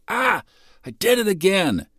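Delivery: high, dismayed oh-no; dismayed realisation. Retold or imitated. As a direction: catch yourself in the act. Imitating realising a mistake.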